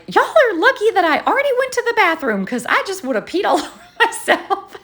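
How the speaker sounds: high, quick, laughing relief; Lily laughing. Retold or imitated. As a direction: gasp it out still shaken and laughing. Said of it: "Lily's like".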